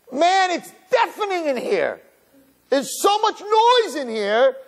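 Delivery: high, slow astonishment; awed wonder. Retold or imitated. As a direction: marvel slowly, as if hearing a roar. Awed realization in the minyan.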